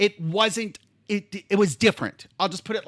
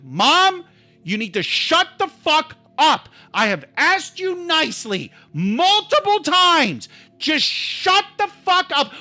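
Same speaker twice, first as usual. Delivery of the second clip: high, heated retold outburst; yelling; 6 semitones higher than usual. Driver snaps at nagging mother in the car, angry retold yell. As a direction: blow up at her, pitch raised. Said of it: "now I've been yelling".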